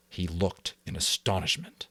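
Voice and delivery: usual speaking voice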